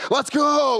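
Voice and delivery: high, mock-eager burst; gung-ho shout. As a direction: charge in with hammed-up enthusiasm. Acting out overeager hammering.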